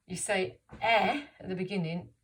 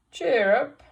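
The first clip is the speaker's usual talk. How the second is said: bright, loud encouraging cheer up; cheerful call; 6 semitones higher, 13 dB louder than usual. Acted. George cheering up the dog, loud and bright. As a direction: lift the dog's spirits. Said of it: "said George".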